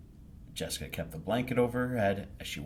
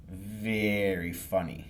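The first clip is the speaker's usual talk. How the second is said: sarcastic; much slower than usual. Acted. Sarcastic reply to teasing.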